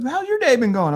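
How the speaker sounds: high-pitched mock friendly question; lightly put-on high voice, making small talk; party bus guy. Party bus guy asks about her day, high-voiced imitation.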